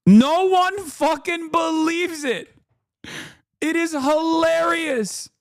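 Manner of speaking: amused. Real, not acted. Gloating at disbelief.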